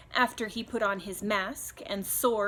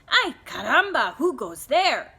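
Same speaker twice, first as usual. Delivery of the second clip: bold challenge; 4 semitones higher, 8 dB louder than usual. Acted. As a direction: challenge strangers out of the dust. Skippy challenges the bandits.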